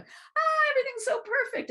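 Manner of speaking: very high, airy, sing-song fake serenity; mocking bliss ninny. Speaker mocks fake-blissful people, very high and sing-song. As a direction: parody someone pretending all is perfect. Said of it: "bliss ninnies who just act like they're totally fine".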